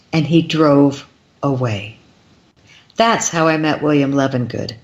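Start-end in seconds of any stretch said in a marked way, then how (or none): none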